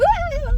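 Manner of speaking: very high imitated yelp; self-startled cat shriek. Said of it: "she scares herself sometimes".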